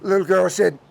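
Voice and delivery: ordinary delivery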